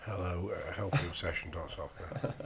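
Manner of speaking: slow, low, unenthusiastic email read-out; unhappy. Real, not acted. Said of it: "you don't sound very happy".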